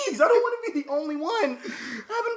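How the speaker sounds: high laughing begging voice; laughing mock plea. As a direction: beg while laughing hard.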